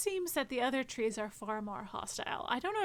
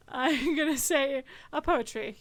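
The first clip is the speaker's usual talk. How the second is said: laughing; 2 semitones higher, 7 dB louder, slower than usual. Real, not acted. Amused repeat of the tree's pun.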